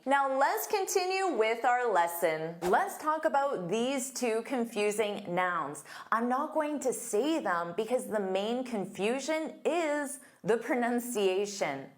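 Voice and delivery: ordinary delivery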